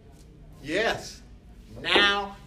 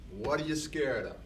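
ordinary talk

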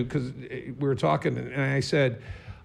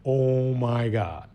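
awed; much slower than usual. Retold or imitated. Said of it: "I was like".

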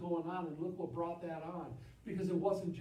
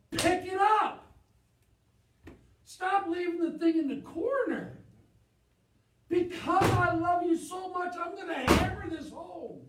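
high, very loud preaching; impassioned shout; 9 semitones higher, 8 dB louder than usual. Real, not acted. Pastor urging church to pick up Bibles, shouting. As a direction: shout it out to hammer the point home.

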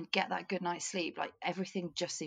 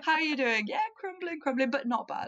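lightly voiced chirpy denial; mock cheerful crumbling; 9 semitones higher, 5 dB louder than usual. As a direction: play at being fine while falling apart. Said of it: "British thing to do".

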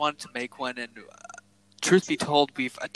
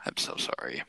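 low, much softer, half-whispered sorry; mock apology; 14 semitones lower, 8 dB quieter than usual. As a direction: grovel in jest, barely voiced. Mock apology to his friend.

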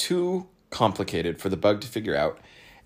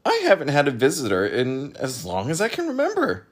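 high, delighted chatter; cheerfully excited; happy; 6 semitones higher, 4 dB louder than usual. A narrator's reading. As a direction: gush to a rare visitor. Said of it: "he cried happily".

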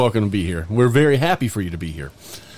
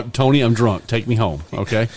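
ordinary talk, nothing marked

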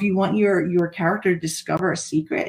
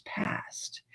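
whispered; 15 dB quieter than usual. Acted. Spooky hushed ending.

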